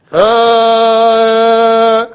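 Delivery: sustained brassy call, very loud; trumpet blast. Shown as a demonstration. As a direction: blow one long trumpet note with the voice. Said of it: "Voice like trumpet".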